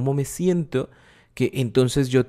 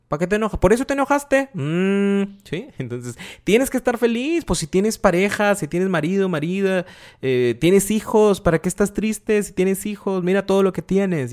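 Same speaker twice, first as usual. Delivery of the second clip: reproche burlón; 6 semitones higher than usual. Retold or imitated. Imitando a quien minimiza emociones.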